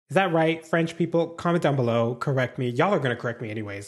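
normal talking voice

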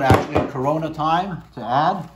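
muffled behind cloth mask; through a face mask. Real, not acted. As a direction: mouth covered by mask.

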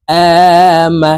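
আমা with the opening আ held long; drawn-out vowel. Shown as a demonstration. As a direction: chant আমা, stretching its first vowel. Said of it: "এক আলিফ টেনে পড়ব". (আমা-র প্রথম আ টেনে পড়া)

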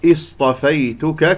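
slow اصطفيتك opening on a clear glottal i; starting with kasra. Shown as a demonstration. Teacher showing how to start, slow اصطفيتك with opening i. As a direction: begin اصطفيتك with a firm "i". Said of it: "أبدأ بهمزة قطع مكسورة".

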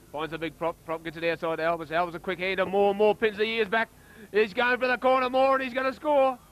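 climbing from lively to a high, loud yell; play-by-play that erupts at the try; building to a yell. Real, not acted. Try being scored, commentary builds.

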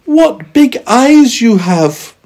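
put-on high, loud, drawn-out little girl; little girl crying out. A narrator's reading. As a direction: marvel slowly in a high girl's voice. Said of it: "she cried".

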